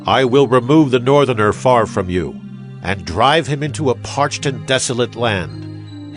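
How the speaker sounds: usual speaking voice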